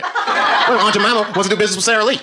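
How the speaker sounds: giggling high through the joke; laughing. Real, not acted.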